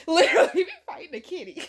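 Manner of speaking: words through laughter; laughing. Real, not acted. Joking about the fight, laughing through it. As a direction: giggle through the line.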